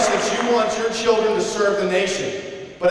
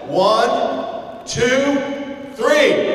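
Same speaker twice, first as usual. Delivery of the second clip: building count to a shout; 6 semitones higher, much slower than usual. Real, not acted. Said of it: "And from the belly".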